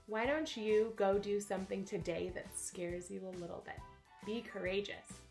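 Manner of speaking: usual speaking voice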